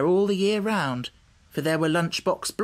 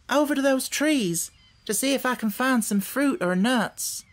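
put-on lightly raised girl's voice, earnest; a hint of a young girl explaining; little girl Dorothy; 9 semitones higher than usual. A narrator's reading. Dorothy explaining the new country to the hen, lightly girlish.

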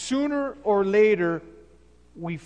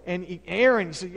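ordinary talk, nothing marked

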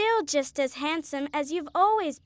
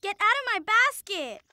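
angry shout; 6 semitones higher than usual. Acted. Girl at a picnic drives an ant from her basket, angry shout. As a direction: shoo the ant off sharply.

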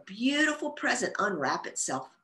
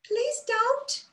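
tiny high squeak of a pleading flower; squeaky bud voice. A narrator's reading. A flower bud begging Bella, tiny high squeak. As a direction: squeak it like a frightened little bud. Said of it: "a voice squeaked".

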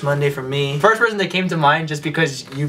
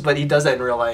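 usual speaking voice

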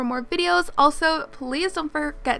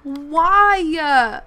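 drawn-out, louder cry of disbelief; loud dismay; 3 semitones higher, 9 dB louder, much slower than usual. Real, not acted. Gamer protesting her favorite's bad luck, loud dismay. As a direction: wail it out at full volume.

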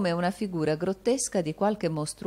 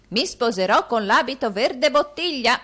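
put-on raised, higher old man's shout; 7 semitones higher, 6 dB louder than usual. A narrator's reading. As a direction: shout it to someone hard of hearing. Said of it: "gridò Arturo Gride".

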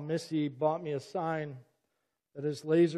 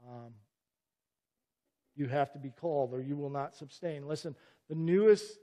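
ordinary delivery

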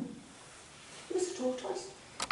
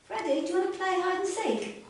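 sly frogs; 9 semitones higher, 6 dB louder than usual. Acted. Frogs invite him to play.

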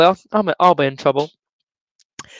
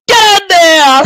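high, loud cry dissolving into laughter; ecstatic cry; 4 dB louder, slower than usual. Real, not acted. Fan yelling get in there at the goal, then laughing. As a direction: yell with pure joy.

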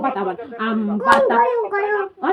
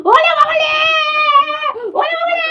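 put-on much higher sing-song baby-talk coo; 14 semitones higher, 3 dB louder than usual. Mother cooing in baby talk to her son. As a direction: coo it up high like talking to a baby.

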